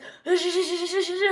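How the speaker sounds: juddering "sh-sh-sh" brake noise; imitated skidding brakes. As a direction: mimic the car skidding on snow. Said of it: "it makes that weird noise".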